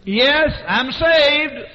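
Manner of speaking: high, loud, slow answer; yelling across room. Retold or imitated. Embarrassed man answers his friend across cafeteria, yelling slowly. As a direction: holler a drawn-out reply over the crowd. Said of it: "yelling across the people".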